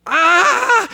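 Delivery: sharp high yelp of alarm; a sudden gasp-cry as you lunge; high startled "Ah!" as he slips. Retold or imitated. Grabbing son falling off trail.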